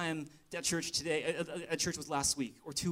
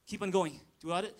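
normal talking voice

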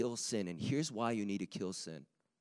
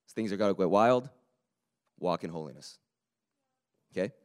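ordinary delivery

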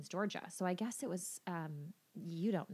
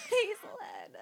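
high, slow, through laughter; laughing; slower than usual. Real, not acted. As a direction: giggle the words out. Laughing at the joke.

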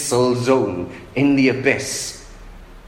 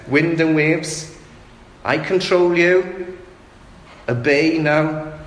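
slow, higher, commanding voice; commanding Jesus; 5 semitones higher than usual. Acted. Jesus orders wind and waves, slow commanding voice. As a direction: order the storm to obey. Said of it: "as if Jesus is saying".

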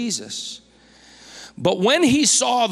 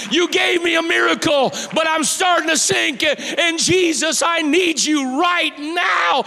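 shouted, much higher, pleading to Jesus; desperate cry for help; 9 semitones higher, slower than usual. Acted. Preacher acting out a sinking believer, shouting for rescue. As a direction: cry out like a man going under. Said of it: "learn how to cry out to Jesus".

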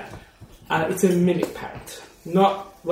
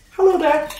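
parrot mimic; 11 semitones higher, 4 dB louder than usual. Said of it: "It looks at you".

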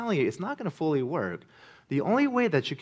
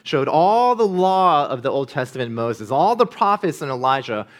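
louder, higher rising sermon climax; full-force preaching; 4 semitones higher, 8 dB louder than usual. Acted. Pastor urges the congregation that only Jesus comforts, preaching at full force. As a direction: drive the point home to the congregation.

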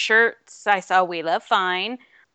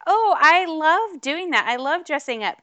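gushing fan; 8 semitones higher than usual. Retold or imitated. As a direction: replay your starstruck reply with a gush. Retells gushing to the actor.

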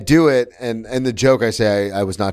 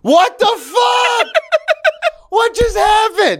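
very high, drawn-out squeal of disbelief; astonished shriek; much slower than usual. Real, not acted. Friend reacting to the surprise apology, high disbelieving shriek. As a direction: screech it slowly through incredulous laughter.

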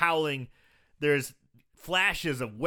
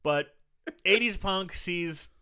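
ordinary talk, nothing marked

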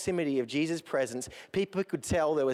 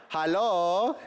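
louder, clipped German hello over the radio; a better German coast guard accent; 3 semitones higher, 4 dB louder than usual. Shown as a demonstration. German coast guard answering a sinking ship, in a German accent. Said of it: "That's better".

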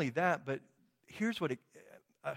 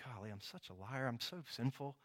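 dejected; 3 semitones lower, 8 dB quieter than usual. Retold or imitated. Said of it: "I'm just all dejected".

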